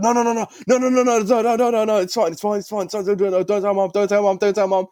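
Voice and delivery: high, rapid-fire repeated pleading; hammed-up frantic hushing, words tumbling out; imitated panic. Brother begging him not to tell mom, imitated panic.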